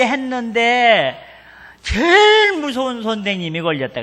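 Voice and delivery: mock the sulking kid's protest; lightly voiced son griping to his father; a hint of a whining child. Child complains about strict teacher.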